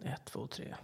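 whispered count, very soft; hushed counting. Retold or imitated. Seeker at the can counting under breath, whispered. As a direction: breathe the count almost silently.

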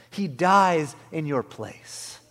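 loud, slow proclamation; emphatic, drawn-out pulpit climax; loud preaching. Real, not acted. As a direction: drive each word home to the congregation. Climax, then hushed repeat.

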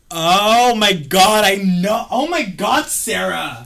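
loud, high, drawn-out oh my god; excited recognition. Real, not acted. Recognizing Sarah in photo.

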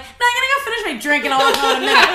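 high, hammed-up breezy insistence; breezy refusal. Telling her friend she's staying, high mock nonchalance. Acting out herself refusing to leave.

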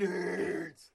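loud drawn-out movie catchphrase shout; playful imitation of an ogre's holler; mock ogre yell. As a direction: bellow it like the ogre, in fun.

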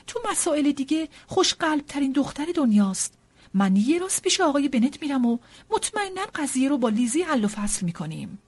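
agitated matron protesting; alarmed mother. Acted.

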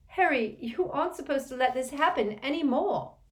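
high, loud pleading rebuke; anguished cry. Acted. Hermione crying out at Harry in the bathroom. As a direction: cry out at him. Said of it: "Hermione cried".

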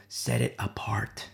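slow hushed whisper. Acted. Samuel tells the cook to set it apart in a whisper. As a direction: let the words drop into a whisper.

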